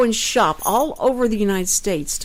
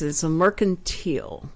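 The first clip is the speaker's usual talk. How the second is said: ordinary talk, nothing marked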